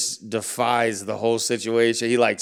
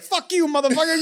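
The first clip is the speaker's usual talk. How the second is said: much higher, squeaky mock of his father cursing; imitating his dad. Guest mocking his dad cursing him out over the phone. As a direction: mimic the old man's defiance for laughs. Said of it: "he's like".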